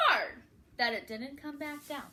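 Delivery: usual speaking voice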